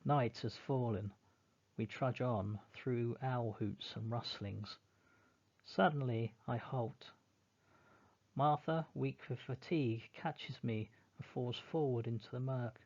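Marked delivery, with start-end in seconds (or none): none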